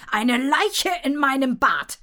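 loud, slow outraged dad; dad shouting. A narrator's reading. Dad storms downstairs, shouting in outrage. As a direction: bellow it like a corpse turned up. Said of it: "hörte ich ihn rufen".

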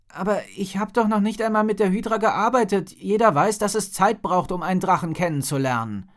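put-on high, anxious girl protesting; upset girl. A narrator's reading. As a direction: blurt out worried objections. Petra upset about tomorrow.

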